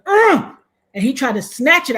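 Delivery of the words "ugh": loud high ugh of disgust; outraged grunt. Retold or imitated. Snatched at by the robber, a loud high outraged ugh. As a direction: groan out in outrage. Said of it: "I was like".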